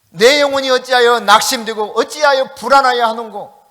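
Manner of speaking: loud, ringing Bible recitation; proclaimed scripture. Acted. Preacher booming the psalm to the congregation, loudly.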